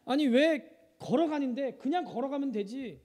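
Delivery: normal talking voice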